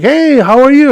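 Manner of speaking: high, effeminate greeting; feminized guy. Retold or imitated. Customer greets the delivery driver, feminized and high. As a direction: imitate the tall guy's feminized hello. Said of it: "I feminized it a little bit".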